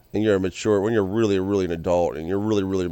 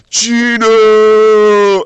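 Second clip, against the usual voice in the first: high, very loud drawn-out stadium yell; full-force fan shout, pitched way up; 11 semitones higher, 11 dB louder than usual. Retold or imitated. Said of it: "I'm, like, yelling. I'm loud".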